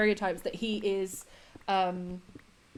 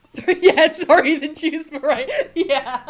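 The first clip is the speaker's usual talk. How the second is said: apology squeezed out through loud laughter, higher and louder; can't stop laughing while repeating the line; 6 semitones higher, 8 dB louder than usual. Real, not acted. Cracking up at the sketch.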